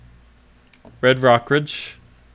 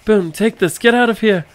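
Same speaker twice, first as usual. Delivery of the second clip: high shout, louder; 9 semitones higher, 5 dB louder than usual. Acted. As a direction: yell it as you strike. Player shouting attack.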